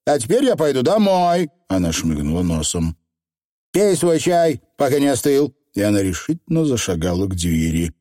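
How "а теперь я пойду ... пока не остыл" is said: a hint of a brisk housewife; lightly voiced no-nonsense woman; brisk village woman.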